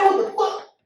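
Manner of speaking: sudden high, punchy "what the fuck"; mock angry outburst. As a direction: replay the snap at the driver who cut him off. Retold road rage outburst.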